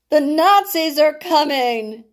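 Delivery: high, urgent holler. Acted. Jonathan warning the family, hollering. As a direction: holler the warning as you run up. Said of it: "Jonathan hollered".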